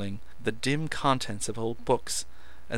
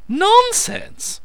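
sudden high shout; abrupt cry; 12 dB louder than usual. A narrator's reading. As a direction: blurt it out loud and high. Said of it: "cried Meadows, so abruptly".

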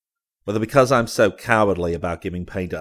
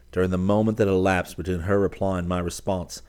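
ordinary delivery, nothing marked

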